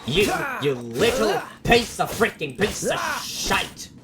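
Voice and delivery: furious yelling at the screen; crying with rage. Real, not acted. Raging at enemy in fight.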